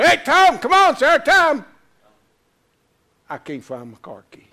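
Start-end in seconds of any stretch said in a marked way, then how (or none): 0.0-1.6 friends hollering across to a golfer; high, loud calling out to hurry him up. Retold or imitated. Golf buddies hollering for him at tee time, impatient loud call. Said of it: "They're hollering for me".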